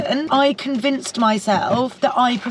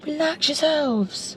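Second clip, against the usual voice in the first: slow, soothing "relax yourselves"; calming instructor; 6 semitones higher, 4 dB quieter, much slower than usual. Retold or imitated. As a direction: soothe the class, drawn out. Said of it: "I keep saying to everyone".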